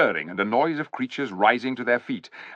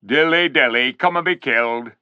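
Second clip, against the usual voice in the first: sneering; 3 semitones higher, 5 dB louder than usual. A narrator's reading. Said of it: "sneered Griffle".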